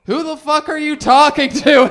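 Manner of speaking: loud, high confused yell; crowd shout. Retold or imitated. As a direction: shout back as the baffled crowd. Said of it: "the rest of the crowd's like".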